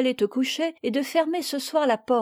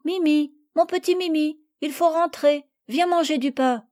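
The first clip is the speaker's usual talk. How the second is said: higher coaxing call; calling sweetly; 7 semitones higher than usual. Acted. Madeleine calling her parrot from the trees, coaxing. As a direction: sweet-talk the bird back inside. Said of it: "Madeleine l'appela".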